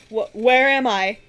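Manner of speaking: slow, loud, breathless question; gasping. Acted. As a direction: gasp it out like just revived. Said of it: "The man coughed and gasped".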